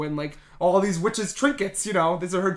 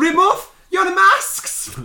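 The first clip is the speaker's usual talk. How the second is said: very high, loud, slow command; Grand High Witch; 12 semitones higher, 7 dB louder, much slower than usual. Retold or imitated. Witch ordering the coven to unmask, loud and slow. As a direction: bark it like a witch queen.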